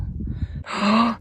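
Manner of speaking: sharp gasp of surprise; surprised gasp. Real, not acted. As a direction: gasp at the sight. Sees baby sheep.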